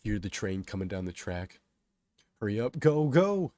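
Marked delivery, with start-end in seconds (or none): 2.4-3.5 urgent shout climbing high; yelling. A narrator's reading. Crowd to the men on the tracks, urgent yell. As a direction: urge them on loudly. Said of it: "people from the crowd yell".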